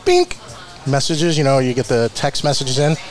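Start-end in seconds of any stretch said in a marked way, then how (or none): 0.0-0.4 a sharp, much higher "bink" like a phone alert; notification ping. Retold or imitated. Host imitates the text message ping, high and sharp.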